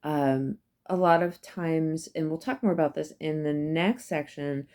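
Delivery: ordinary talk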